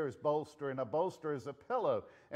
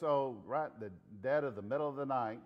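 ordinary talk

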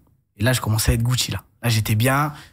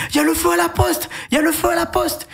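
panicked yell. Retold or imitated. Postman yelling to colleagues that the post office is on fire, high panicked cry. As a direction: shout the alarm in a panic, pitch way up. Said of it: "Et je criais".

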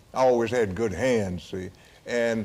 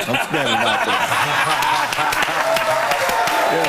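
slow words broken by laughter; laughing at his own embarrassment; 4 semitones higher, 4 dB louder, slower than usual. Real, not acted. Old ballplayer to interviewer, laughing at his own story. Recalling standing in only his jock.

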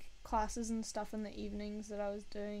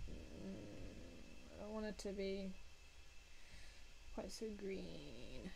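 ordinary talk, nothing marked

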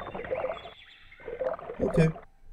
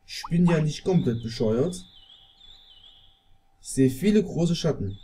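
ordinary talk, nothing marked

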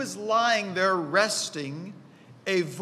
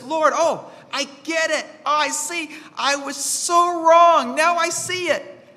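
sudden realization; 8 semitones higher, 5 dB louder than usual. Retold or imitated. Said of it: "that aha experience that says".

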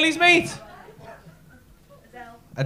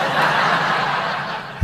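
breathy laugh. Real, not acted. Comedian laughs at crowd answer.

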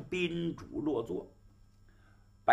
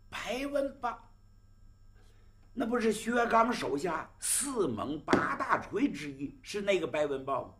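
astonished; 6 semitones higher than usual. Acted. Host astonished at name.

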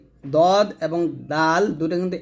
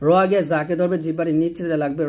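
ordinary talk

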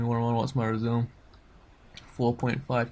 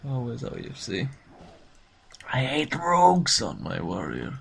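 ordinary talk

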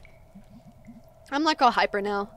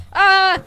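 short, loud, high yelp; loud open "ah" cry; scream; 8 semitones higher, 12 dB louder than usual. Real, not acted. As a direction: let out a startled-sounding scream. Player reacts to scary scene.

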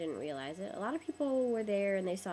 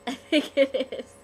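laughing; 7 semitones higher, 13 dB louder, slower than usual. Real, not acted. Laughing at own hat.

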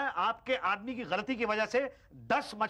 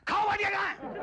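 angry yell; 13 semitones higher than usual. Acted. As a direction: bellow for Bhatia like a boss. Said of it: "तो मैं बोलूँगा खाओ भाटिया".

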